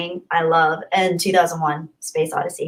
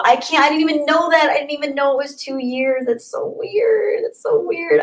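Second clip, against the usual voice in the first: touched disbelief; 7 semitones higher than usual. Real, not acted. Streamer learning it's been two years, high touched wonder.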